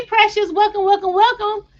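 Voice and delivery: high, bright greeting to a viewer; excited welcome. Real, not acted. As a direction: sing out a welcome to Precious.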